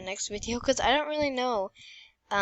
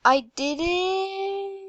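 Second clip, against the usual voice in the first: slow drawn-out victory cheer; triumphant; 8 semitones higher, 7 dB louder, much slower than usual. Real, not acted. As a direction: celebrate the win. Wins level.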